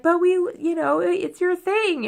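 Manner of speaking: slow down and pitch up to mimic pleading commenters; imitating viewers. Vlogger quoting viewers about her crying, mock-pleading.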